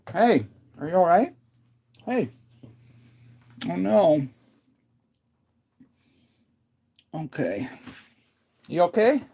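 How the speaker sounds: high, loud anxious calls to the dog; worried calling. Real, not acted.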